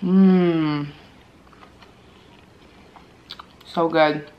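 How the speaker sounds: talking while chewing food; muffled mmm through food; mouth full, chewing. Real, not acted. Seen 0: Bites wing at -2, lips closed chewing throughout, wing in hand.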